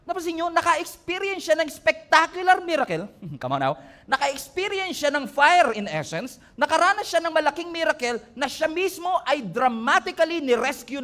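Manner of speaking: high, loud, driving preacher's voice; preaching at full force. Acted. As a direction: preach it hard to the congregation. (nangangaral nang buong lakas at malakas na boses)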